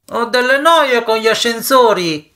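high, sustained call at normal volume. A narrator's reading. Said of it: "gridò ancora Jory". (richiamo acuto da lontano)

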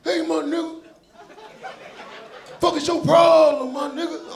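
put-on high-pitched, confrontational thirteen-year-old boy; little boy voice. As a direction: imitate a cocky little boy picking a fight. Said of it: "a little thirteen-year-old little boy voice".

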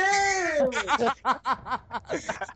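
big falling laugh; loud laugh. Real, not acted. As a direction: burst out laughing. Laughing after insult.

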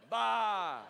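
loud, long "bah"; one big play-acted bleat; sheep's bleat. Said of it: "The sheep said".

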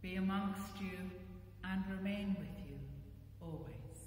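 usual speaking voice

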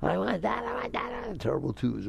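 put-on high-pitched toddler whining demands; child's tantrum. Young child demands something in a store, mock tantrum. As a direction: mimic a tantrum in a high kid voice. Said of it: "they throw tantrums".

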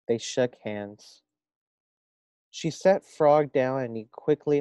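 lively storybook reading voice; reading to children. A narrator's reading. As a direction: read it aloud to a class. Reads story aloud to first graders.

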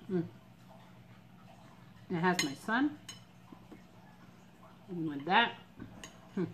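talking between bites; chewing while speaking; mouth full of food. Real, not acted. Seen 0: Eating on camera; takes a spoonful at 8.2, then chews while speaking.

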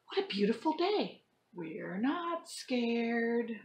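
lively storybook read-aloud; reading to children. Acted. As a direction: act the story out for kids. Teacher reads picture book to class.

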